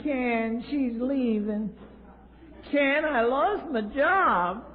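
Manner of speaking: mock the self-pitying caller; whiny wimp. Callers whining to Ken about their troubles, slow and high.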